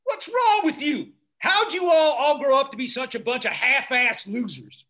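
loud disgusted tirade; rising up, yelling in disbelief; angry. Acted. Father berating his kids.

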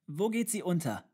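put-on high, loud child's question. A narrator's reading. Child presses tutor with question. (hohe, laute Kinderfrage)

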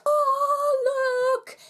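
delighted high squeal showing the flip-flops; excited squeal. Real, not acted. Gushing over Sleeping Beauty flip-flops.

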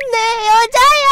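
1 mock possessive claim. Acted jealous claim.

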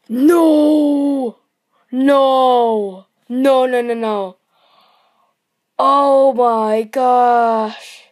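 loud overjoyed screams of disbelief; excited shouting. Real, not acted. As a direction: yell no in thrilled shock. Big card pulled, yelling.

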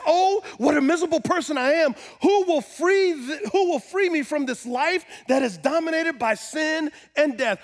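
high, impassioned cry for rescue; Paul's cry of need. Acted. Paul cries out over sin and death, pleading loudly. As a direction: cry out as one needing to be freed. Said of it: "it's a cry of need".

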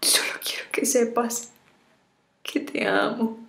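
tearful, half-whispered farewell; tragic heroine sorrow. Acted. Farewell video to lover.

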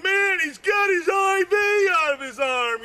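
mock alarmed yell; panicked shout. Retold own panic.

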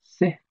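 se said with a rising high tone. Shown as a demonstration. Teacher to students, showing se goes up. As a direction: lift the se up high. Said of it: "That means go up".

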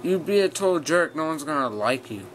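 ordinary delivery

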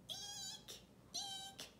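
tiny high squeak, very soft; squeaky shoe eek. Shown as a demonstration. Reading a picture book, squeaking like a shoe. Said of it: "like a squeaky shoe".

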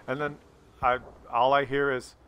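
ordinary talk

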